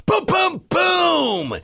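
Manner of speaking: high-pitched vocal booms, last one longest; booming crash. A narrator's reading. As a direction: imitate crashing giant footsteps. Giant's footsteps imitated by narrator.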